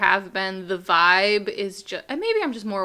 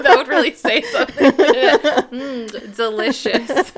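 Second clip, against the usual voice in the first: laughing through the words; giggling mock relish; 4 semitones higher, 7 dB louder, slower than usual. Real, not acted. Joking about eating strawberry baby.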